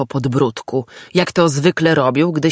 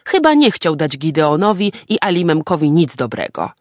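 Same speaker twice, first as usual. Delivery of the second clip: usual speaking voice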